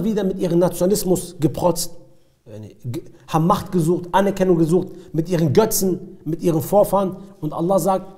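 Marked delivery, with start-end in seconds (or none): none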